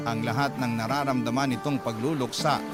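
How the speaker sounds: usual speaking voice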